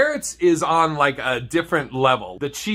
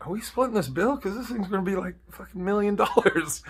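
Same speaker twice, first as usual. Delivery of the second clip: higher, hushed worried inner voice; lightly anxious thought; mock panic; 6 semitones higher, 5 dB quieter than usual. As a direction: fret silently over the bill. Panicked inner voice about bill.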